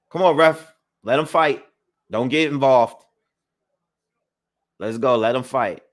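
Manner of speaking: loud, high call at the ref; yelling from the sidelines. Real, not acted. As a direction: heckle the referee from ringside. Shouting at the referee.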